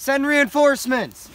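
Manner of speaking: loud battlefield command; shouted order. Acted. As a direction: bark the order across the field. Calling out in battle.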